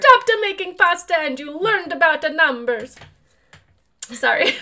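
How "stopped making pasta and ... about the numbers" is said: higher hammy Italian mama; mock Italian accent, scolding. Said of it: "my bad Italian accent".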